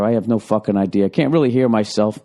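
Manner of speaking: usual speaking voice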